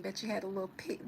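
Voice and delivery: normal talking voice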